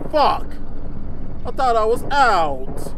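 loud frustrated yell; frustrated shout. Real, not acted. Caught again.

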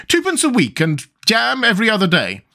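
high-pitched queen making an offer. A narrator's reading.

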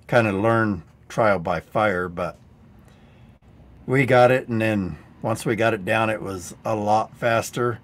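ordinary talk, nothing marked

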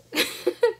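short high laugh; happy laugh. Real, not acted. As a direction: laugh with relief. Laugh celebrating license.

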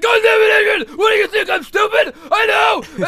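loud, high mock bellow; Rocky shouting back. Rocky argues with Adrian, shouting in imitation. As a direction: yell back as an exasperated husband. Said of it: "And Rocky's like".

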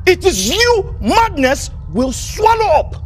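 slow, high, emphatic shout; high, drawn-out angry curse; furious repetition. Acted. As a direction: drive each word home slowly. Retort repeated emphatically.

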